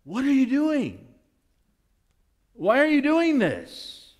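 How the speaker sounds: high, baffled, drawn-out protest; bewildered protest. Retold or imitated. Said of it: "John's like".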